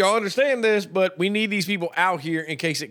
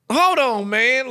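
incredulous protest; 8 semitones higher, 4 dB louder, much slower than usual. Pretend. Hunter protesting.

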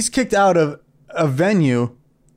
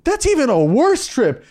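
mock disbelief; 8 semitones higher than usual. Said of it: "I was like".

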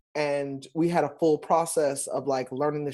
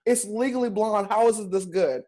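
higher, incredulous marvel at the show; amazed disbelief; 5 semitones higher than usual. Retold or imitated. Fan raving about Legally Blonde, higher incredulous delight. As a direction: gush like it shouldn't be this good. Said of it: "And I'm like".

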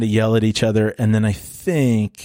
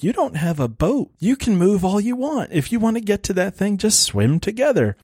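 higher-pitched, incredulous arguing; exasperated objection; 8 semitones higher than usual. Retold or imitated. Viewer arguing with stranded movie characters, exasperated. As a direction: plead with them to see the obvious.

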